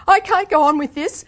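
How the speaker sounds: loud, high, at breaking point. A narrator's reading. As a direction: cry out that it's unbearable. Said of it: "I said in a high voice".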